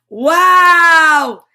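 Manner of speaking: loud high shout of surprise; surprised wow. Real, not acted. Host hears she won the round, loud surprised shout. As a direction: burst out in delighted surprise.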